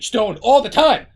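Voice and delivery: high, very loud proud declaration; mock boasting shout. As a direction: build to a hammed-up yell. Climax of acted self-diagnosing person.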